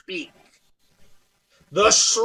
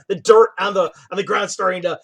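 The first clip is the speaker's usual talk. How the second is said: ordinary talk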